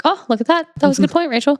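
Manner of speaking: mock self-praise. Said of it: "I'm like".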